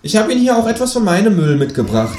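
high, cheeky kangaroo. A narrator's reading. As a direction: pitch way up for the kangaroo. Kangaroo character voice.

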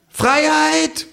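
high, drawn-out proclamation; kangaroo proclaiming. A narrator's reading. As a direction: cry it out like a speech from the bar. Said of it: "ruft das Känguru".

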